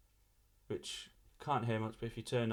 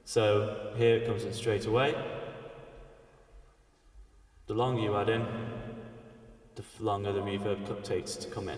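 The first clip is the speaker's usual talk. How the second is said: reverb with pre-delay; 5 dB louder than usual. Shown as a demonstration. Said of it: "the time it takes before the reverb comes in".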